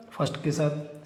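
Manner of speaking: murmured repeat of the rule; soft echo. Shown as a demonstration. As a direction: echo first के साथ quietly as a drill. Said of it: "first को first के साथ".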